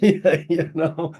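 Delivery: laughing. Real, not acted. Laughs at hot tub hypnotist.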